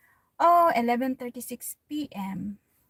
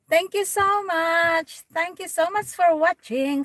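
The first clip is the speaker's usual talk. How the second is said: higher, louder thank you to the viewer; excited thanks; 8 semitones higher, 8 dB louder than usual. Real, not acted. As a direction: stretch out the gratitude. Thanking viewer on live stream.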